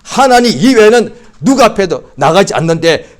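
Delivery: ordinary delivery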